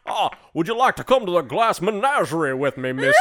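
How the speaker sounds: put-on court a lady like an old Southern colonel; Southern gentleman.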